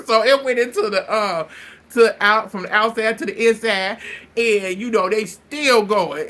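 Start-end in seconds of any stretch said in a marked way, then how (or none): none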